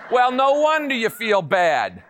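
normal talking voice

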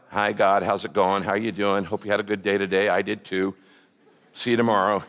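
low monotone, offhand chat with God; mock-casual, bored drone; perfunctory prayer. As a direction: rattle off small talk without meaning it. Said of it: "God gets so bored with perfunctory prayers".

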